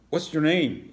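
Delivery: nervous shaky question. A narrator's reading. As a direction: let the voice tremble a little. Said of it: "with a bit of a shaky voice".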